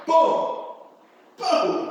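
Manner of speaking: bang it out like a collision; mock crash noises; crash sound. Crash sound effects in the story.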